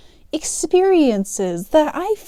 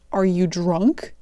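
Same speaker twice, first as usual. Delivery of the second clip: slow, lower deadpan imitation; 4 semitones lower, slower than usual. Customer questioning the teen cashier, slow imitated deadpan. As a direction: imitate a completely serious question, no hint of sarcasm. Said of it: "looks at me deadpan, completely serious".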